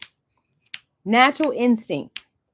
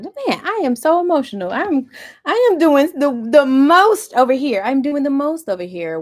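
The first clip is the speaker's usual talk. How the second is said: mock overwhelmed complaint; 6 semitones higher, 7 dB louder than usual. Mocking someone overwhelmed by their feelings, hammed-up whine. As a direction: whine that you're doing the most. People who see emotions badly.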